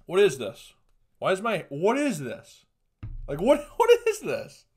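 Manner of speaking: loud, high-pitched incredulous questioning; exasperated disbelief. Real, not acted. Aghast at own hair.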